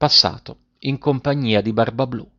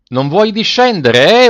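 storybook yell; 8 semitones higher, 6 dB louder than usual. A narrator's reading. Bluebeard to his lingering wife, yelling. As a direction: holler it impatiently, stretching the words. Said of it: "urlava Barbablù".

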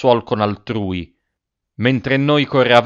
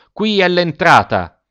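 much higher, louder call announcing the entrance; boatman's shout; 7 semitones higher, 4 dB louder than usual. A narrator's reading. Ferryman yelling at the travellers, high and loud. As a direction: shout it across the marsh. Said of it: "gritò".

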